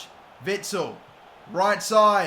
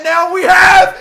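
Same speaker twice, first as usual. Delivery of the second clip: high, much louder goal yell; ecstatic scream; 9 semitones higher, 12 dB louder, slower than usual. Real, not acted. Gamer celebrating a late goal, screaming. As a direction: explode with joy.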